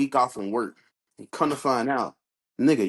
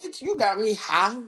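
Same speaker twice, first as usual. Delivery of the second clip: high, sassy mock girl voice; 9 semitones higher, slower than usual. Teasing his friend, squealing as the high girl. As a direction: play her sassy and high-pitched.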